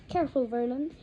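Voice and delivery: usual speaking voice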